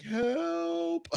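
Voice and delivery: very high, thin squeaky cry for help; mock plea for help. Friend cuffed in the cop car, squeaky mock plea. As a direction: squeak it like a helpless kid.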